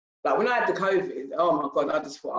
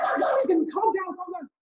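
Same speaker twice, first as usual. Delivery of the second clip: imitating her own tearful meltdown, pitched way up; blubbering breakdown. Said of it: "I was like a blubbering baby".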